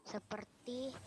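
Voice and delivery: faint, far-off mumble; almost no voice; barely audible. Real, not acted. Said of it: "Aduh, suaranya terlalu kecil". (suara sangat pelan)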